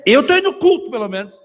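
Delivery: high, loud protest; defensive husband. Retold or imitated. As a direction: snap back in defence. (marido se defendendo, bem alto)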